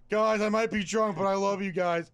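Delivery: slow and sappy like a tipsy buddy; tipsy friend voice. Retold or imitated. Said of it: "remember Bert was like".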